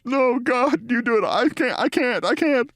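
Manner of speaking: high, pleading, anguished; mumbled anguish. Retold or imitated. Maher refuses to tell Scott, in a high pleading anguish. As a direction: beg off, too ashamed.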